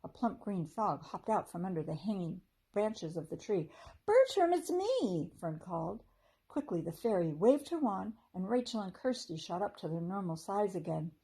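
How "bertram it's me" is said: calling out. A narrator's reading. Fairy calls out to frog.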